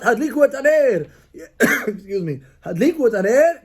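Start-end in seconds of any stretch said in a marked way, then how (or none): 0.0-1.1 sharp, higher barked command to light the candles; harsh order. Shown as a demonstration. Husband snapping at family to light candles, harsh bark. 2.7-3.6 harsh order. Shown as a demonstration. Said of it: "they're not gonna do it".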